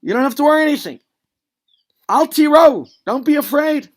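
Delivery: loud, high, rallying call to the troops; rousing pep talk. Acted. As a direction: shout encouragement to a frightened army. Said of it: "He's telling them".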